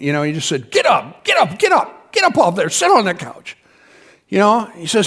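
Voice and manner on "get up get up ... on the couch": sharp retold command, a shout on the middle get up; harsh order. Preacher woken at two at night ordering the woman off the floor, harsh. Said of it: "I spoke to her a little harshly".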